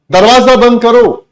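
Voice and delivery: shouted command, pitched high; loud order. Shown as a demonstration. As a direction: bark the order at the class. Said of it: "मैंने आपको कहा". (ऊँची आवाज़ में आदेश)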